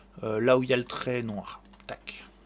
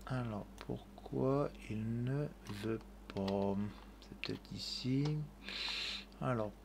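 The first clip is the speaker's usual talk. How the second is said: puzzled muttering; 7 dB quieter than usual. Real, not acted. Tutor wondering why the tool won't work, puzzled muttering. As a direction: draw it out quietly, puzzling it over. Puzzled when software command fails.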